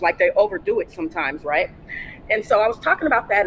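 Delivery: ordinary delivery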